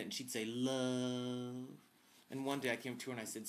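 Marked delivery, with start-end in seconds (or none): 0.4-1.7 imitated Southern drawl on one long word; Southern accent. Imitating the craft service woman's answer, drawn-out Southern accent. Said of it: "she talked like this".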